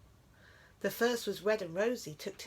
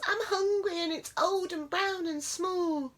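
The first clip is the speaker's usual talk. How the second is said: much higher pleading squeak; pleading mouse; 10 semitones higher than usual. A narrator's reading. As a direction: beg like a hungry mouse. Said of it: "Please, said Mouse".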